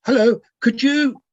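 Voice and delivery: usual speaking voice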